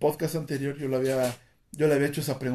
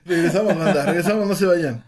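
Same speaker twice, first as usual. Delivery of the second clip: still laughing; 4 semitones higher, 8 dB louder than usual. Real, not acted. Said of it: "el ataque de risa".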